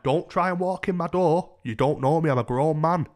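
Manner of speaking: annoyed. Retold or imitated. Grown man warning stranger out of his room, annoyed. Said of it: "I was annoyed about it".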